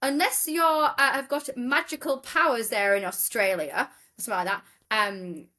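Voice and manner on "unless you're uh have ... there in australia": high, mock-stern judge voice; imitating the judge. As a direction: put on a pompous judge for the punchline. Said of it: "and he's like".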